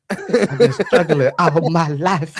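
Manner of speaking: slow words broken by laughter; laughing. Retold or imitated. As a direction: laugh through the line, drawing it out. Laughing through a quoted complaint.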